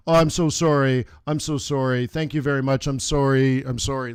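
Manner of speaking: loud, gushing mock apology; hammed-up sorry patient; over-apologizing patient. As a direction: grovel to the doctor, overly sorry.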